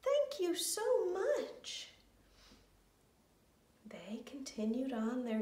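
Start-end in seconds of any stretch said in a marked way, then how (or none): 0.0-1.9 high, slow grateful squeak; relieved mother hedgehog, pitched high. A narrator's reading. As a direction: draw out the thanks in a high voice. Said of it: "she squeaked".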